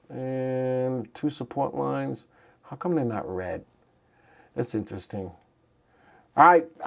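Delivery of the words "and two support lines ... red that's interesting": low, soft thinking aloud; quietly musing while checking something; muttering to self. Real, not acted. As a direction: drop to a private, puzzled murmur. Puzzling to self over chart colors.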